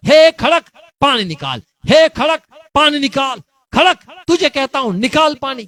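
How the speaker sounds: high, loud cry at the rock; God tells Moses to shout at the rock; shouting command. Acted. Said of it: "चिल्ला के बोल".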